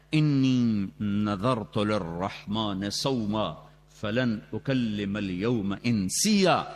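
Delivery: slow, measured chant of the verse; Quranic recitation. Acted. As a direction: recite it like a qari, drawn out.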